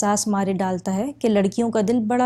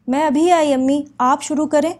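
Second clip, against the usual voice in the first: higher, louder answering call; daughter calling back; 6 semitones higher, 4 dB louder than usual. A narrator's reading. Daughter tells mother she is coming, calling back. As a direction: call back to mum, pitched up.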